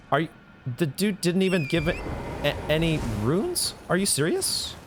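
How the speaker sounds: can't believe you got nothing; incredulous. Real, not acted. No runes after kill.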